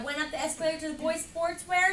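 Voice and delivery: ordinary delivery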